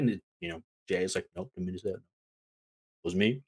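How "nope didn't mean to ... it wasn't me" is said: low, soft disowning of blame; mock sheepish denial. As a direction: deny it under your voice, imitating the culprit. Jay's denial, voiced by host.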